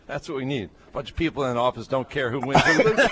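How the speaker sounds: high, fast mock coach voice, rising into a laughing squeal; imitating Spurrier. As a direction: ham up the coach, laughing at the end. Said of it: "That's a pretty good imi-, imitation".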